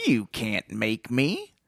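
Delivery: slow, soft whining protest. Acted. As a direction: whine it like a coward. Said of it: "objected in a whining voice".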